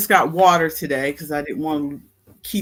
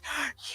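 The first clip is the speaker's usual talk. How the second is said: a quick, high gasp of fake astonishment; imitating people gasping in disbelief; mock gasp; 13 semitones higher, 11 dB quieter than usual. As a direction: gasp as if shocked by the news. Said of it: "I have four kids".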